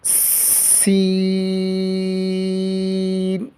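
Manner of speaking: سین with a hissing s and the vowel held very long; سین recited with madd and a whistling s; long and whistled. Shown as a demonstration. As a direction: stretch سین slowly and whistle the s. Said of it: "اس پہ مد بھی کرنی ہے اور اس میں سیٹی کی آواز".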